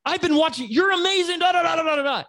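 very high-pitched, gushing excitement; imitating a fan who's lost his cool; starstruck fan. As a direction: go from casual to fawning disbelief. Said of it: "his entire demeanor changes".

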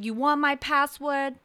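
loud indignant question; incredulous New England caller; talking loud. Retold or imitated. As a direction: throw it back loudly in disbelief. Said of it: "And she's talking loud".

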